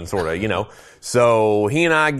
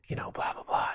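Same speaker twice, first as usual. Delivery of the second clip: hushed whisper; 13 dB quieter than usual. Retold or imitated. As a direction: keep it barely voiced, sneaking. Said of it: "my buddy's just talking real low like this".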